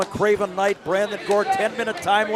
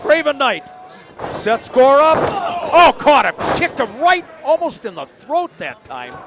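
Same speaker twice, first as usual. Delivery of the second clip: raised, louder action call; excited play-by-play; 4 semitones higher, 4 dB louder than usual. Real, not acted. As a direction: call each hit as it lands. Calling strikes in the match.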